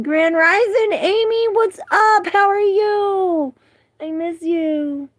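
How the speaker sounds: high, loud, drawn-out calling hello; exuberant greeting. Real, not acted. Greeting Amy as she joins, exuberant high loud call. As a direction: holler the greeting up high, stretching each word. Calls out greeting to arriving viewer.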